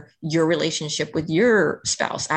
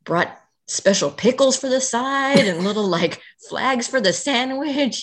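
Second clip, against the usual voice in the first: playful enthusiasm; 8 semitones higher than usual. Retold or imitated. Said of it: "he's like".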